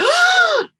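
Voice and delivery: loud, high mock gasp of shock; a big hammed-up gasp. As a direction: gasp like a shocked girl in the audience. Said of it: "The girls are like".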